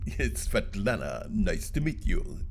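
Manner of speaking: slow, low, soft Russian woman imitation; Russian-accented English. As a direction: imitate her Russian accent, quiet and unhurried. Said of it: "spoke that English with that accent".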